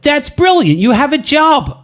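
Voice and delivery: put-on high, bright-sounding American accent. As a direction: exclaim it in a hammed-up American accent. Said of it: "excuse my American accent".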